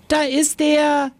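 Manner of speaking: high, slow, eager call. A narrator's reading. Little brother spotting his branch, calling out eagerly. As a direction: call out the find, drawn out. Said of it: "rief Kiku".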